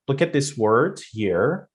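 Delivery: ordinary talk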